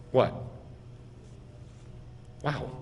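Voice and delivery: usual speaking voice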